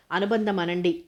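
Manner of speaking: plain reading voice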